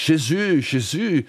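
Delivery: high ringing call of "Jésus", pronounced the French way, zhay-ZU; ringing cry in French. Retold or imitated. Narrator voicing Joan crying out from the stake, high ringing call. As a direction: let "Jésus" ring out high over the crowded square. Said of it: "Haar laatste woorden schalmden over heel de markt".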